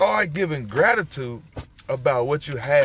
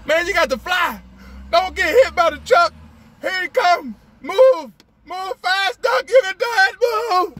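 much higher and louder, yelling at the duck to run; urgent calling out; 6 dB louder than usual. Real, not acted. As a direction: cheer the duck across the road before the truck. Cheering a duck across traffic.

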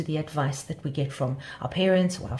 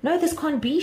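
higher, dismayed storybook cry; alarmed shout; 11 semitones higher, 4 dB louder than usual. A narrator's reading. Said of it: "shouted Butterfly Sue".

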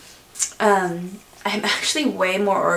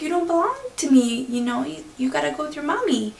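gentle let-down; 8 semitones higher, faster than usual. Retold or imitated. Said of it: "he's like".

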